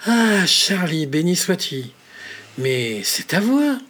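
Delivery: slow, loud joyful exclamation; delighted cry. Acted. Richard in his coffin, hearing his brother's voice, cries out delighted. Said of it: "s'écria Richard, ravi".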